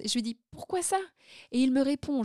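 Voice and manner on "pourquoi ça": higher, lightly puzzled question; surprise rejouée. Retold or imitated. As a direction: act out mild surprise. Retold question to receptionist. (question étonnée, voix plus aiguë)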